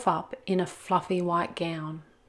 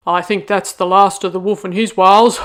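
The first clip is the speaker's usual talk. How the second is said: gloating ram; 12 dB louder than usual. Acted. Rambo gloats over the wolf.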